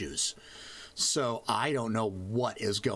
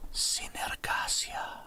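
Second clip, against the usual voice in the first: slow, breath-heavy whisper with an accent; ASMR read; 4 dB quieter, slower than usual. Shown as a demonstration. Repeating the world's name for laughs, accented ASMR whisper. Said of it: "almost my A S M R read on that".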